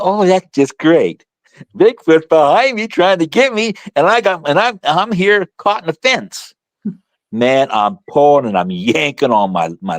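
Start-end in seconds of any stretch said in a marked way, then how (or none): 0.0-6.5 mock despair while stuck; sarcastic panic. Boy caught in a fence with Bigfoot behind, sarcastic panic. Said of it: "I'm thinking".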